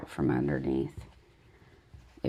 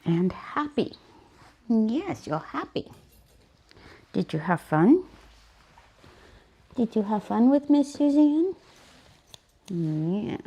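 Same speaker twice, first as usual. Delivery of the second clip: praten tegen de hond; 6 semitones higher than usual. Real, not acted. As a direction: prijs de hond als een kleintje. Talks to the dog.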